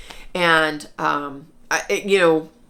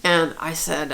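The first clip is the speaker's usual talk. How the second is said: usual speaking voice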